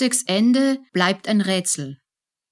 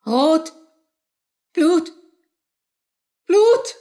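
each Blut higher and louder, ending in a scream; rising to a scream; 13 semitones higher, 5 dB louder, much slower than usual. Acted. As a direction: horror building to a shriek. Käthe sieht Blut an Hand. (von Entsetzen bis zum Schrei)

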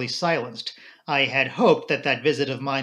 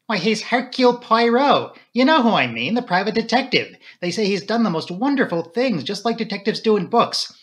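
higher, louder, eager rush of news; breathlessly impressed, voice lifted high; excited revelation; 8 semitones higher, 5 dB louder than usual. A narrator's reading. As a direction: gush about the famous detective. Flora excitedly reveals who he is.

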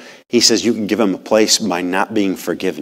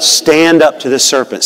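rally the church with it, loud; said really loud; 6 semitones higher, 4 dB louder than usual. Shown as a demonstration. Preacher leading the congregation in a loud call-back. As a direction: Stand up to the serpent called out louder and a bit higher. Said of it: "Say it really loud".

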